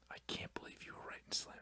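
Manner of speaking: barely audible, hushed aside; whispered disbelief. Real, not acted. Host appalled by friend's slam poetry, whispered disbelief. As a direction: whisper it as if to yourself.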